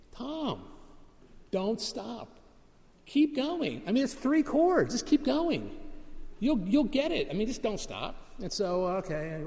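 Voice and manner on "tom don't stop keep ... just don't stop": high-pitched mock yell over the band; Frankie's yell. Guitarist Frankie yelling encouragement at Tom mid-song, high mock yell. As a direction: call out high, urging him not to stop. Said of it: "he yells at me".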